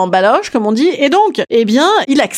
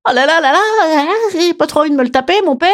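whiny princess; 7 semitones higher than usual. Retold or imitated. Princess voiced in the retelling.

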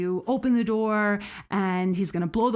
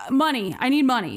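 higher and louder, a mock desperate demand; retold hijacker demand; 5 semitones higher, 4 dB louder, faster than usual. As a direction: press it like a cornered man asking for cash. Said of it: "Reggie answers in Cebuano".

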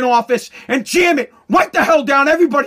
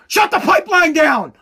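sudden raised-voice order, cutting in; hammed-up barked command; mock boss's shout; 4 semitones higher, 3 dB louder than usual. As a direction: shout down the objection as a stubborn boss. Acts boss Joe shouting over employee.

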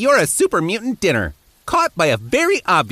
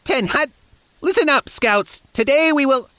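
grumpy scoutmaster; 8 semitones higher than usual. Acted. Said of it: "I couldn't keep a straight face doing that".

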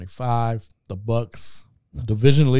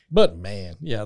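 usual speaking voice

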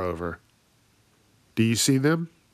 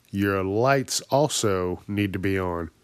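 ordinary delivery

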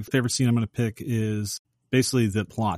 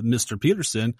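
ordinary talk

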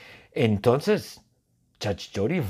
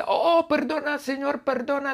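very high, pleading granny voice; abuela pidiendo ayuda. Acted. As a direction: call for attention in a high little-old-lady voice. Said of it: "yo soy una pobre abuela". (voz de abuelita aguda y suplicante)

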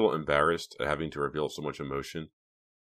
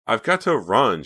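higher, louder brush-off; cold; 6 semitones higher, 8 dB louder than usual. A narrator's reading. Reva cuts Hank off to leave, coldly. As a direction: dismiss him briskly and emphatically. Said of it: "she said coldly".

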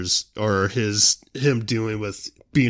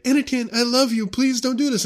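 very high pleading falsetto; high Padme impression; 14 semitones higher than usual. Padme begging Anakin, in a squeaky high pleading voice. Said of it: "she's like".

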